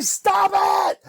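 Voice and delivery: high-pitched mock shriek; exasperated outburst. Telling her to stop asking, mock exasperated shriek. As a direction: beg her to quit, at wit's end.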